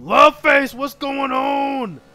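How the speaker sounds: high, loud, slow drawn-out hello; calling out a greeting. Real, not acted. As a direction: call across the room to a friend. Greeting a viewer.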